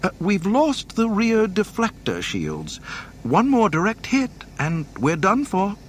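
very high, anxious droid voice; C-3PO. A narrator's reading. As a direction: report disaster in a high, urgent pitch. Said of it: "C three P O interjected".

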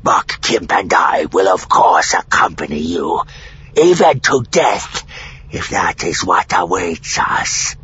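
rough, growly alien pledge; solemn rasp, swelling in the middle; dark vow. A narrator's reading. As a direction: pledge loyalty in a dark creature voice. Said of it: "Sakasak said darkly".

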